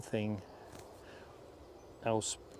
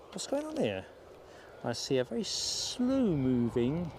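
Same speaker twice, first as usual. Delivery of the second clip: surprised; 2 semitones higher than usual. Real, not acted. Spots something odd on runway.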